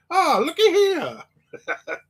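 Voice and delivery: very high, slow put-on voice breaking into a laugh; Carmen Legge impression. Retelling the blacksmith's entrance, high and slow with a laugh. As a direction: stretch it out high, laughing through it. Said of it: "he walked in there and goes".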